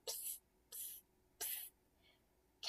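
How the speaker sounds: breathy secret psst, no voice; whisper. Acted. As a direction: hiss it like a secret.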